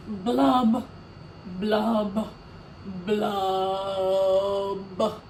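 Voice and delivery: mock sobbing blub blub blub; hammed-up fish crying noises; dramatic blubbing. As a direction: fake weepy blubs, fading. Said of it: "He is a very dramatic pout pout fish".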